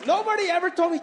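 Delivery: ordinary delivery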